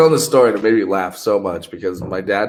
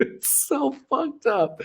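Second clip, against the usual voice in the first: laughing; 6 dB quieter, much slower than usual. Real, not acted. Laughing at the puppy story.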